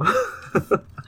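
squealing laugh that falls; high falling laugh. Real, not acted. Mummy joke laughter.

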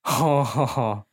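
lightly mocking impatient huff; impatient grunt. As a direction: show how annoyed he sounds. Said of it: "这样不耐烦".

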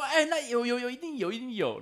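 high-pitched eager retelling; convinced, hopeful crowd. Retold or imitated. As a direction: imitate hopeful anglers reassuring each other. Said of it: "他就给大家信心".

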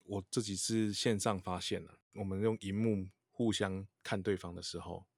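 ordinary delivery

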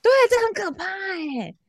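high-pitched amazed exclamation; gasping in mock alarm. Real, not acted.

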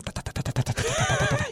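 fast rattling da da da sound; imitating flapping cape sound. Shown as a demonstration. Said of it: "披风会这样".

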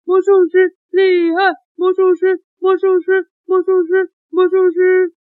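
high rhythmic chanting; cheering crowd. Acted. Audience hailing the magician after the trick, excited chant. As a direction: chant the name like an adoring audience. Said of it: "全场观众欢声雷动".

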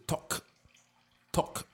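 talk, talk, each cut off sharply on the final k; k ending shown. Shown as a demonstration. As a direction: talk said slowly with a clipped k stop at the end. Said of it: "它其实是talk".

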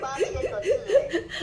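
slow proud boast, laughing; proud exclaim. Real, not acted. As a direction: stretch it out, bursting with pride. Proud of her big project.

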